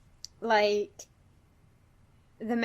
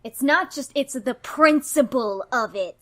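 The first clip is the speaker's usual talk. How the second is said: loud, insistent, angry rebuttal; pissed off; 2 semitones higher, 8 dB louder than usual. Acted. Nikki arguing with her partner, pissed off. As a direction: cut in hard and stand on principle. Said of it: "is really pissed off on behalf of Cecil".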